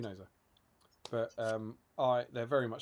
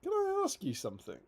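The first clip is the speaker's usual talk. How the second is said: lightly voiced interviewer, pitched up. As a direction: put a question as a curious interviewer. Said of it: "the interviewer goes to him".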